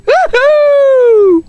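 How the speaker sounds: high, loud whoop of joy; excited cheer. Real, not acted. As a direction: whoop it up, celebrating. Cheering a big catch.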